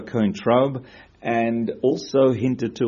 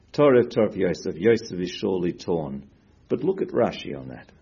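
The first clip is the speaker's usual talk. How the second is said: ordinary delivery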